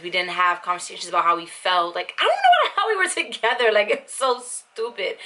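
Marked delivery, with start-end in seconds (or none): 2.2-5.2 giggling through disbelief; laughing. Real, not acted. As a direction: laugh it off, amused. Laughing at her own past relationship.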